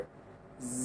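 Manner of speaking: জ with teeth together, hissy; buzzing friction. Shown as a demonstration. Teacher models the rubbing sound for students, hushed. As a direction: let জ buzz like a cricket. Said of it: "একটু ঘষা দিতে হবে".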